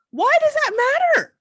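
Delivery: high, loud exasperated outburst; real frustration. Real, not acted. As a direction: cry it out in frustration, pitch shooting up. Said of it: "I get so frustrated".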